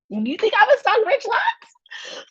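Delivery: high incredulous laughing squeal. Retold or imitated. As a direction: squeal it through laughter in disbelief. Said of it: "Earth was like".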